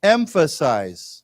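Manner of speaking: "Emphasize" drawn out slowly for repetition; model pronunciation. Shown as a demonstration. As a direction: say "Emphasize" slowly and clearly, falling. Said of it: "let's say together".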